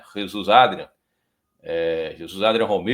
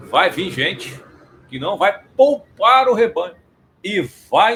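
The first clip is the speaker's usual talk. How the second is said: loud, high warning from the pulpit; forceful preaching; 8 semitones higher, 6 dB louder than usual. Acted. Preacher warning the congregation of false teachers, loud.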